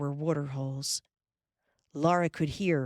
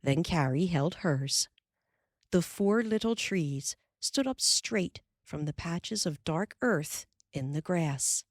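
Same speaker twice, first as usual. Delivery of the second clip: ordinary delivery, nothing marked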